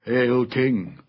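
ordinary delivery, nothing marked

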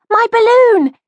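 put-on high, loud, joyful little boy; beaming delight. A narrator's reading. Small boy gets his lost balloon back, beaming. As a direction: cry out with childish delight. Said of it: "he beamed".

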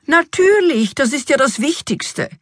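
high, emphatic exclamation; excited realization. A narrator's reading. King remembers the fairies, excited realization. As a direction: burst out high, then ease down.

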